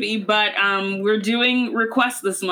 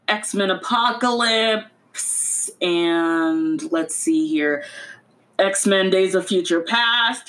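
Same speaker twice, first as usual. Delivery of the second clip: normal talking voice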